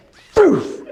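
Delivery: high, loud whooshing phew with a laugh; the dog zooms off; dog's whoosh. Pretend. Dog bolts off.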